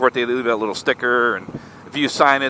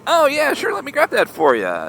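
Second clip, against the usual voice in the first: imitating a bubbly, helpful clerk, pitched high at the start; mock-cheerful, high-pitched opening; bubbly and happy; 11 semitones higher than usual. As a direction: chirp it like someone back from vacation. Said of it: "all bubbly and happy".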